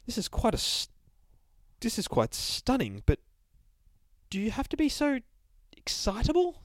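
prim narrator. Acted. Fancy narrator comments primly.

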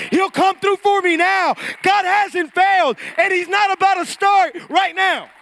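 very loud, high, driving sermon climax; full-force preaching. Real, not acted. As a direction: proclaim it to the whole church at full power.

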